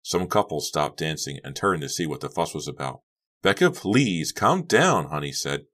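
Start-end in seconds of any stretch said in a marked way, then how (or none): 3.4-5.1 high, raised plea to calm down; urgent, pitched-up appeal; pleading. A narrator's reading. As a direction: beg a screaming friend to settle. Honey pleads in party quarrel.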